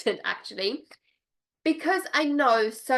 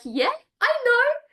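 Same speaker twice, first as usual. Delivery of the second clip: squeaky, much higher knowing voice; giddy high-pitched told-you-so; knowing squeal; 6 semitones higher than usual. Retold or imitated. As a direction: play it like you always knew, squealing high. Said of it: "I'm like".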